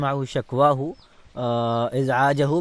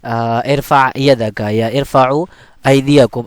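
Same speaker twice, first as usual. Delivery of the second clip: slow, firm classroom command, like telling pupils to raise hands; teacher's command; 8 dB louder than usual. Shown as a demonstration. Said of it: "تو آپ کہتے ہیں مثلاً".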